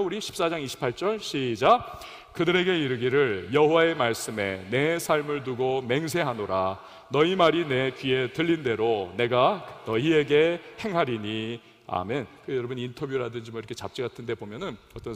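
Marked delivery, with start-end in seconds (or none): 2.3-11.6 scripture reading. Shown as a demonstration. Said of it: "한번 읽어볼까요".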